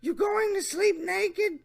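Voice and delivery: high mock leer; Jeff Stacy voice. Reading the predator's question, high mocking voice. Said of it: "my Jeff Stacy voice".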